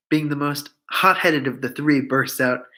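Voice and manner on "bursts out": ordinary delivery, nothing marked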